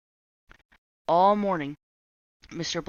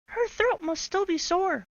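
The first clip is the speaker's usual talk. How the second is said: put-on high children's voice, quick excuse; kids covering up; 3 dB louder than usual. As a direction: fib like guilty kids.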